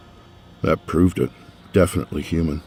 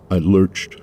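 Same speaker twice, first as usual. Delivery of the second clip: usual speaking voice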